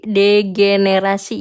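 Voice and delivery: DEGENERASI slowly, syllable by syllable; careful pronunciation. Real, not acted. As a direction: sound out Degenerasi carefully after stumbling on it. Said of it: "apa sih?".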